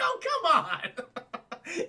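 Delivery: very high, drawn-out comic complaint; whining mock protest. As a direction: wail it through laughter. Acting insulted robber's protest.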